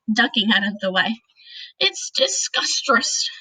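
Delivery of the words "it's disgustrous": slow, much higher gurgling giant voice; gurgled. A narrator's reading. Giant gurgles in disgust at the snozcumber. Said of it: "the B F G gurgled".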